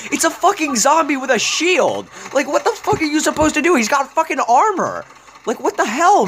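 furious gamer shouting mid-fight; frustrated yelling. Real, not acted. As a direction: shout your disbelief at the game.

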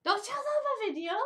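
high, loud protest; indignant squeal at a friend's teasing. Real, not acted. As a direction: object loudly in a high-pitched voice. Mock quarrel, protesting a playful accusation.

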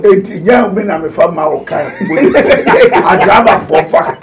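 talking through bursts of laughter; laughing. Real, not acted. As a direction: break up laughing mid-sentence. Amused outburst during heated banter.

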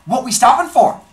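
put-on girl in the car alarmed the driver stops; uptight alarm. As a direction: snap upright and demand it. Girl sits up, gets uptight.

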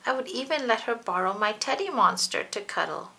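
ordinary delivery, nothing marked

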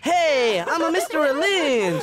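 high, slow, loud teacher parody; mock a teacher in a silly kid's voice; Mister Lynch. Making fun of the teacher on request, hammed-up impression. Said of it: "Do Lynch now".

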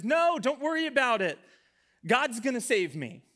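mock-confident man in a joke; confident refusal. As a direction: wave off the rescuers with breezy certainty. Drowning man waves off rescuers.

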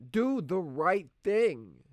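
slow and loud, each word pressed; forceful. Acted. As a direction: say it firmly to yourself. He says it himself, forcefully.

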